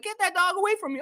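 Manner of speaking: high, put-on voice for his own past line; mock-shoo the dog away in a high voice; imitating himself. Delivery man telling the lady to call off her dog, high mock voice. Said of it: "I was like".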